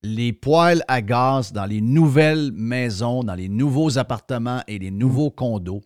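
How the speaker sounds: slow, weighty delivery; slow and emphatic. Real, not acted. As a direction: spell it out slowly.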